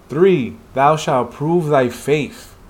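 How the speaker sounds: measured, solemn recital; slow solemn reading. Acted. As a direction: read each commandment slowly and gravely. Reading commandments in the manhwa.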